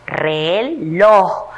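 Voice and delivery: "reloj" split with a gap: re, loj; separado en sílabas. Shown as a demonstration. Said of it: "luego vamos a separar en sílabas". ("reloj" más grave, separando re y loj)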